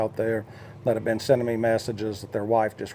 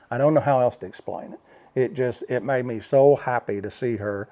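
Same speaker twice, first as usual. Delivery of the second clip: normal talking voice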